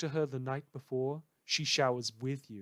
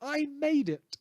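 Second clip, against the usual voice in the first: mock proud shout; 12 semitones higher, 6 dB louder than usual. Podcaster showing off to his mother, mock proud cry. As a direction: crow it like a kid to his mother. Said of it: "excited to be able to say".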